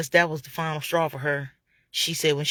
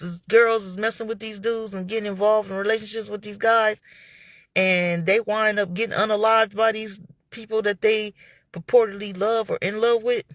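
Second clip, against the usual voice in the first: ordinary talk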